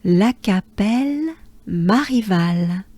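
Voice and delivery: La Capelle Marival said smoothly in French; one breath, La Capelle Marival; French full name. Shown as a demonstration. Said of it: "Take a good breath".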